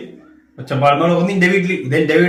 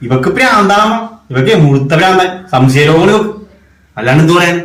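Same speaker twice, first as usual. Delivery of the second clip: loud exasperated shouting to his mother; angry shouting; 6 dB louder than usual. Acted. Husband shouting to his mother about his wife, angry. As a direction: yell it out, fed up with her.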